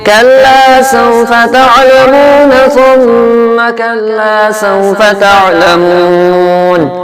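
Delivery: loud sung Quran recitation; قراءة مجودة. Acted. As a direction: recite the full surah in melodic tajweed. Full recitation of surah. (تلاوة مرتلة)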